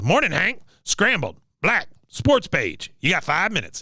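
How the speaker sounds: mock commanding officer, pitched high; Cotton. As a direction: toss out orders in a put-on high voice. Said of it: "He goes".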